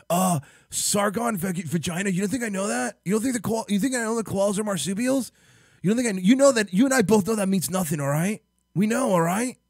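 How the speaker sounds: high, loud, spluttering outrage; mock furious rant. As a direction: build into a ranting fit. Escalating ranting argument.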